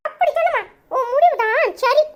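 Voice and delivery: put-on gleeful child. Child gleefully telling mother how grandma panics, high kid voice. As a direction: play a little kid gloating over grandma.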